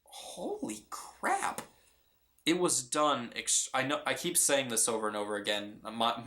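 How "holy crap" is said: higher, slowly drawn out in disbelief; awed holy crap. Retold or imitated. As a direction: stretch "Holy crap" high and slow, stunned. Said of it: "I was like".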